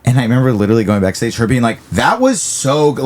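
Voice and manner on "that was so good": surprised praise. Retold or imitated. Booker surprised, caught off guard.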